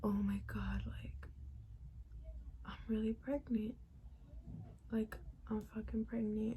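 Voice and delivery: breathless whisper of disbelief; shocked whisper. Retold or imitated. Staring at the positive test, a stunned whisper.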